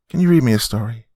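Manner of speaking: put-on high, hopeful child voice; little girl. Daughter asking mother for story, little-girl voice. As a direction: ask sweetly as a small girl.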